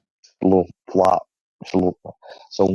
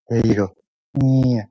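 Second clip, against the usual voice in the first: ordinary delivery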